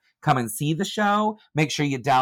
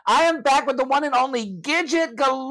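excited introduction; 11 semitones higher than usual. Acted. Introducing co-host with flourish.

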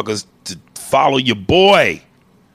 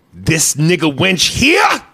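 slow, raised, threatening shout; movie villain line; 6 semitones higher, 3 dB louder, slower than usual. Acted. Threatening to hurt Kerry Washington, slow villain shout. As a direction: menace it slowly, rising hard on the last word. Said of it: "after he says the line".

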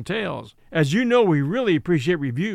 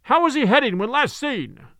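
higher, calling aloft; captain calling up; 7 semitones higher than usual. Acted. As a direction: call up the mast to the lookout. Ahab calls to lookout.

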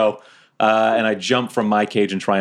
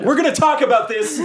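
yelling from afar. Acted. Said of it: "now I'm yelling 'cause we're further away".

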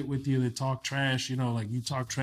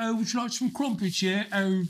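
higher, hammed-up British accent; terrible British accent; 8 semitones higher than usual. Shown as a demonstration. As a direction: tease with a bad British accent. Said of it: "I don't do a really great British accent".

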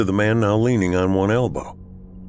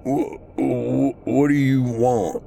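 frightened stammer; 5 semitones higher, slower than usual. Acted. Terrified man, gun pointed.

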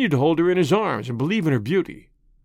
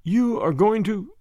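slow, higher, tentative voice; tremulous; 5 semitones higher, slower than usual. A narrator's reading. Woman starting to ask the doctor, tremulous. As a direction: begin hesitantly, slow and high.

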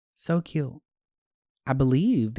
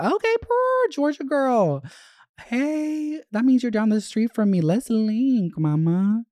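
much higher and louder, squealing with joy; squealing excitement; 10 semitones higher, 4 dB louder than usual. Real, not acted. Host reacting to a fan's note, squealing with joy. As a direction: shriek it like great news.

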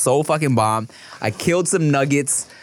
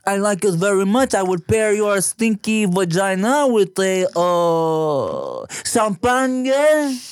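put-on French accent; 5 semitones higher, slower than usual. Continues French sommelier bit.

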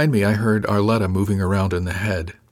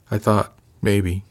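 slow, hesitant, tapering away; slower than usual. A narrator's reading. Crew member making her case to the officer, tapering off. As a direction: let the words slow and trail off. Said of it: "Her voice tapered off".

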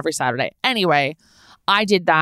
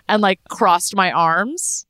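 normal talking voice

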